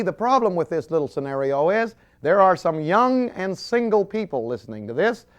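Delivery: usual speaking voice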